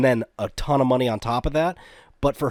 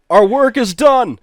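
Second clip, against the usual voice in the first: high, loud victory declaration; mock triumphant shout; 8 semitones higher, 10 dB louder than usual. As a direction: proclaim it like a jubilant rebel. Voicing IRA members celebrating.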